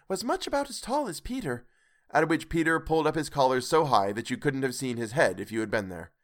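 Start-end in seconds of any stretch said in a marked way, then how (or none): 0.1-1.6 Martha quoting. A narrator's reading. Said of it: "how the lord".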